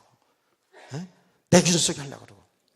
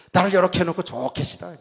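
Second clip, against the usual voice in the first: raised, louder mock praise with a sour edge; sarcastic prayer; 4 semitones higher, 7 dB louder than usual. Preacher retelling his angry prayer to God, sarcastic. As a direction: act out a resentful jab at God. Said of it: "삐딱해가지고".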